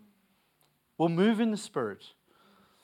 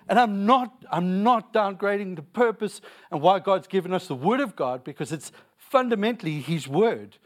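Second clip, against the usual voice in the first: preaching at full force; 4 semitones higher than usual. Acted. Pastor urging the church to follow the Spirit, preaching at full force. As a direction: drive the point home to the congregation.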